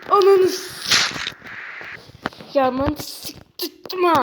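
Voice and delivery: slow, loud, high furious yell; angry shout. Real, not acted. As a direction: drag it out in rage. Player raging at the game.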